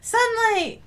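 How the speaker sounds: high, eager cry with a falling end; calling out. Pretend. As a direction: call out to it longingly. Calls out to the outside.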